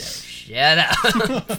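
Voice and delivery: slow. Real, not acted. Slow teasing shut up.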